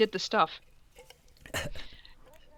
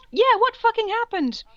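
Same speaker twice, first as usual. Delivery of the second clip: high, louder squeaky mock of the friends; mock high echo; 12 semitones higher, 4 dB louder than usual. Friends echoing the question in a high mocking squeak. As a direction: pitch it way up to parody them.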